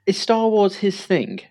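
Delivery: high, slow questioning auntie voice; lightly mimicked auntie; imitating auntie. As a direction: draw it out up high like a curious aunt. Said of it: "me Auntie Shirley was like".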